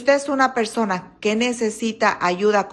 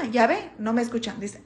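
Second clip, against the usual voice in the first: ordinary talk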